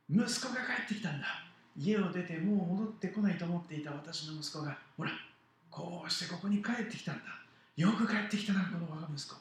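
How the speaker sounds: father welcoming his son home, loudly overjoyed; overjoyed loud cry. Acted. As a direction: proclaim the son's return to everyone. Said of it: "大声で言った".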